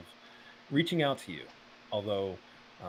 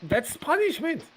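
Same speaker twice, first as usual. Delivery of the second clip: high, loud protest; indignant shout; 8 dB louder than usual. Acted. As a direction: cry out at the injustice. Character protests loudly.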